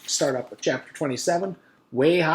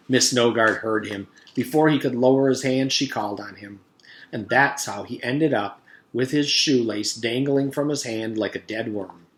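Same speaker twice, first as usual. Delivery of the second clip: ordinary delivery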